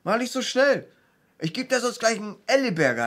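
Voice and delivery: high, loud complaining shout; angry. Retold or imitated. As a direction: yell it like an angry man who lost the fight. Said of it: "Und er war so sauer".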